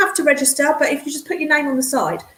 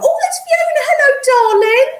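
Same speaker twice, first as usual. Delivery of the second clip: excited greeting; 13 semitones higher, 6 dB louder than usual. Real, not acted. Greeting a friend joining stream.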